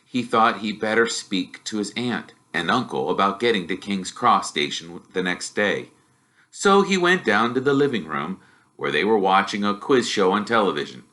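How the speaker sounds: usual speaking voice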